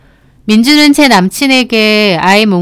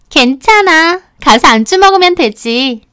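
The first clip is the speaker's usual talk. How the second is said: higher-pitched, easygoing character voice; breezy friend; 7 semitones higher than usual. Acted. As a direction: brush it off brightly in a raised pitch. Late friend answers breezily.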